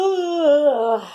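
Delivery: loud, high put-on yawning ugh; sleepy yawn. Dog yawning at Pony to make him sleepy. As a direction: yawn it out as sleepily as you can. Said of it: "as sleepily as he possibly could".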